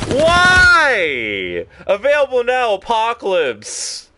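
high, loud yelling wail; loud high cry of frustration; frustrated yell. Real, not acted. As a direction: howl at losing the fight. Getting shot in game.

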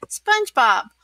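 lightly acted boss's yell; mild mock yell. A narrator's reading. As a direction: call out impatiently, without shouting. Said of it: "yelled Mister Krabs".

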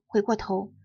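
plain reading voice

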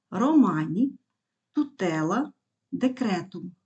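"романі, тутела, декретум" stressed on the second-last syllable; penultimate stress. Shown as a demonstration. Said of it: "Наголос падає на другий склад від кінця".

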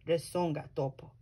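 ordinary delivery, nothing marked

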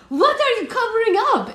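put-on very high, loud little-boy squeal; frantic, hammed-up child shriek; desperate to know. A narrator's reading. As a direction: beg to hear the secret. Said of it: "Emmerich asked, desperate to know".

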